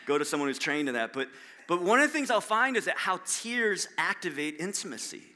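ordinary talk, nothing marked

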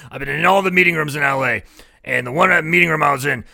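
mock a man holding forth; retold quote. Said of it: "He goes".

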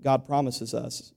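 ordinary delivery

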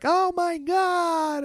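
very high, drawn-out wail; mock freak-out. Group watching him get thrown, mock freak-out. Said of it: "and they freak out".

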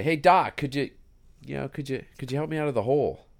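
high, loud shout up from the bottom of a hole; calling out for help. Retold or imitated. Man stuck in hole shouts up at passing doctor, high loud call. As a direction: holler up at a passer-by to get his attention.

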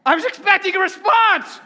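mock scolding. Real, not acted. Prodding silent congregation.

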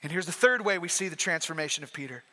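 ordinary delivery

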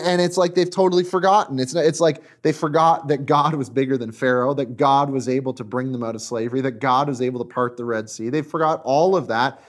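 usual speaking voice

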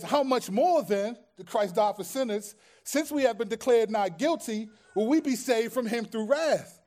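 loud, high, rising preacher cry; fervent preaching. Acted. Pastor reads Romans to the church, loud and fervent.

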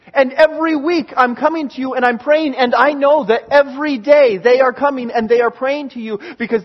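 urgent intercession, repetitive and pressing; insistent pleading prayer. Retold or imitated. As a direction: pile it up, then beg for mercy. Retold insistent prayer, building.

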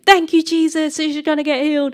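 bold prayer. Retold or imitated. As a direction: proclaim it aloud in prayer, retelling. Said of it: "I'm gonna pray again".

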